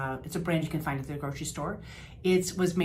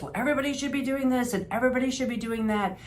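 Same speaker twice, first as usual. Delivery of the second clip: mock lecture; 7 semitones higher, faster than usual. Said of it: "You hear this".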